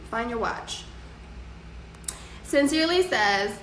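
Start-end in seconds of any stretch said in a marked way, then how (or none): 0.1-0.8 "Find your watch" softer, a quiet final reminder; soft closing advice. Shown as a demonstration. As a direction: leave them with "Find your watch" gently. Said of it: "that's my advice for you".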